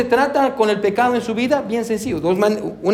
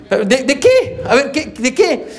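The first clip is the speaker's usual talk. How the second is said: a bit higher, laughing, slow and probing; teasing wife; 4 semitones higher, slower than usual. Retold or imitated. Pastor voicing his wife demanding details, laughing. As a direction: tease him into spelling it out. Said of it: "hermana Valerie, es igual".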